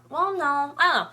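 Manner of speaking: ordinary delivery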